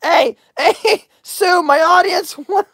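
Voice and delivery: breaking into laughter before finishing; high voice that cracks into a laugh; laughing mid-question. Real, not acted. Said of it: "I can't even talk".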